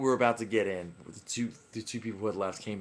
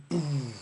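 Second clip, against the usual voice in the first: drawn-out mimicked bass drop; imitated bass boom; 3 semitones higher than usual. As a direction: sound out a falling bass hit.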